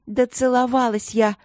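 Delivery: high-pitched indignant protest; heated self-defence; defensive cry. A narrator's reading. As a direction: call it out, stung. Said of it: "крикнула Эбигейл".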